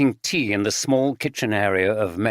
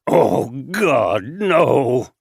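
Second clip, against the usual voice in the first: louder, despairing cry of denial; anguished moan; 4 dB louder, much slower than usual. A narrator's reading. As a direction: cry out as the truth hits.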